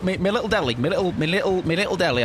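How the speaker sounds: high cartoonish voice with a stammering me me; silly put-on voice. Streamer riffing on the deli perk in a silly high voice. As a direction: squeak out me little deli like a goofy pirate.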